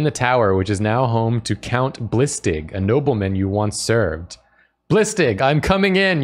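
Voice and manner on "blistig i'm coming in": shouting. Acted. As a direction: shout it through the gates. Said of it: "you shout".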